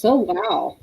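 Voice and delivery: ordinary talk, nothing marked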